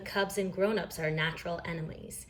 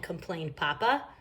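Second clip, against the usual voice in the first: usual speaking voice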